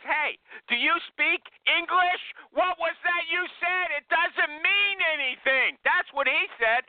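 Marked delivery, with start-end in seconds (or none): 0.0-5.8 pitched-up voice of a boss who can't believe it; incredulous boss. Retold or imitated. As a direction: scoff at her like a baffled boss. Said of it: "He says".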